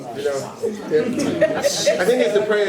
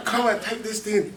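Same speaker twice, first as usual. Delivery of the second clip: mock yell; 8 semitones higher, 4 dB louder than usual. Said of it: "she screamed".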